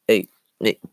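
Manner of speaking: usual speaking voice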